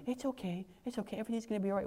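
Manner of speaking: soft, fast, hushed reassurance; mock soothing hush. Preacher mocking a comforting God, soft and quick. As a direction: breeze through it like a sarcastic lullaby.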